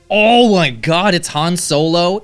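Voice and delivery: high, loud, drawn-out gasp of disbelief; shocked realisation; surprised. Real, not acted. Sudden Han Solo reveal.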